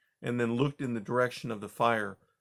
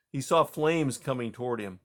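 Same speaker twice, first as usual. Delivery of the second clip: ordinary delivery, nothing marked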